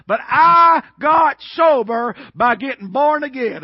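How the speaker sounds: slow, loud proclamation; preaching shout. Real, not acted. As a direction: shout it out to the congregation.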